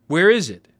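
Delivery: put-on eager boy demanding his free pizza. A narrator's reading. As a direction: push the question out hard. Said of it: "demanded Russell".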